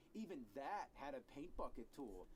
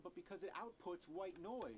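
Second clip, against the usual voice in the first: ordinary delivery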